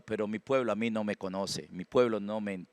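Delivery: low, soft, understated lament; quoting the Lord. Retold or imitated. The Lord about a people who don't know him, soft lament. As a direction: voice God's complaint quietly and low. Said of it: "Dice el Señor".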